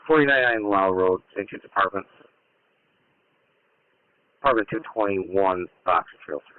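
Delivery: low tinny voice over a scanner; distant radio transmission; police radio. Real, not acted. Readback over radio channel.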